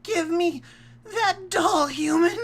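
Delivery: very high, loud cartoon pony demand; a tiny pony yelling at a human; angry pony. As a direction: squeal it like a furious toy pony. Voicing a pony demanding doll.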